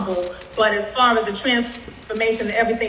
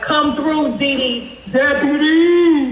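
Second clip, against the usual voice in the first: high, laughing hype call with the last word stretched slowly; hyped cheer; 5 semitones higher, 4 dB louder, slower than usual. Retold or imitated. Colleague cheering on the new deputy, laughing hype shout. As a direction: cheer her on like a crowd hyping a friend. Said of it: "That's why we like".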